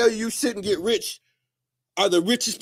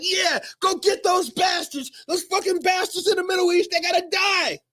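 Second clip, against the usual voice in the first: high, mock war-crazed shouting; fired-up patriot; 7 semitones higher than usual. Ranter mocking viewers stirred up by war speeches, high mock shout. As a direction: ham up a riled-up flag-waver calling for blood. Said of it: "you're like".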